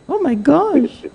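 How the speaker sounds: Oh my gosh in a high squealing put-on voice; shocked squeal at the caller's story; mock squeal. Host reacts to caller's story.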